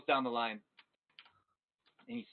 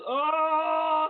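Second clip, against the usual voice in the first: much higher, loud cry of pain; pained scream; 10 dB louder than usual. Acted. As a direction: yelp as the hot rock burns his hands. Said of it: "And he screams".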